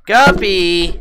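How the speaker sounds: loud high call to the pet. Real, not acted. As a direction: call the pet's name sharply. Calling the pet away.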